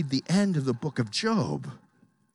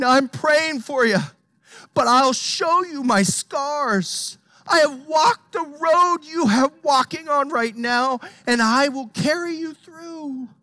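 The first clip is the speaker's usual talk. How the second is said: a touch of earnest, raised Christ voice; 9 semitones higher, 6 dB louder than usual. Acted. Preacher voicing Christ showing his scars, earnest and raised. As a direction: promise it with conviction, loud and high. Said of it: "he's saying".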